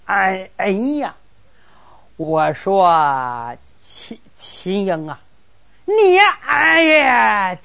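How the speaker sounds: slow, drawn-out crying wail; wailing call. Acted. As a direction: Cheng Tong bursts in and wails to Qin Ying. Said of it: "进来就喊". (拖长哭腔地哀嚎)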